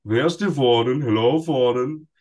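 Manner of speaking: a hint of a drunk jailer calling for the warden; playing the drunk jailer Frosch with a German lilt. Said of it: "and I'm German".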